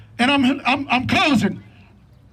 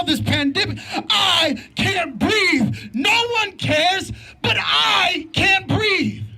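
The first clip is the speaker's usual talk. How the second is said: preaching at full force; 3 semitones higher than usual. Real, not acted. Father addressing rally crowd about pain and police reform, preaching. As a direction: preach it to the crowd, drawing out each line.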